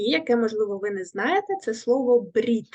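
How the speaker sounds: usual speaking voice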